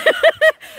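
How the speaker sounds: high, loud cackling laugh; real high-pitched laughing fit; burst of laughter. Real, not acted. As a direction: crack up at your own confession. Laughs after admitting menopause.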